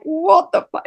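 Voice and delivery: high, exasperated disbelief; confused outburst under the breath; baffled. Retold or imitated. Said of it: "and I'm like".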